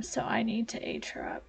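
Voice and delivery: unvoiced whisper, as if keeping a secret. Real, not acted. Gamer whispering a plan to herself. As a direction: breathe it out in a whisper. Hushed aside while playing.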